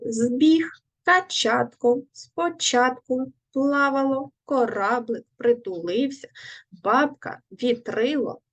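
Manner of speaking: each word said slowly and separately, stressed syllable marked clearly; careful stress drill. Shown as a demonstration. Teacher modelling word stress for pupils, careful drill. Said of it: "поставимо правильно наголос в цих словах".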